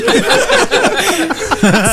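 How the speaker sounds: high-pitched burst of laughter; laughing. Real, not acted. Game master cracking up after the bastard line, laughing.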